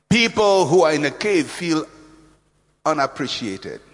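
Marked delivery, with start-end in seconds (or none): none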